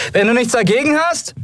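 very high-pitched call over the storm. A narrator's reading. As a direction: call back sharply against the wind, voice pitched high. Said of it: "rief Endriel und spuckte Staub".

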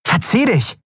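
high, angry dismissal; snarling. A narrator's reading. Andriel orders the dealer away, snarling. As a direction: drive him off with a sharp high snarl. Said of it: "fauchte sie".

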